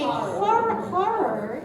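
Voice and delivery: "horror" with an open "har" first syllable; careful HORROR. Shown as a demonstration. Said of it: "I taught myself how to say horror".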